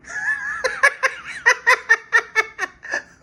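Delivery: shrieking high laugh of disbelief; high burst of laughter. Real, not acted. Laying the two pistols side by side, bursting into high laughter. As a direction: crack up in amazement.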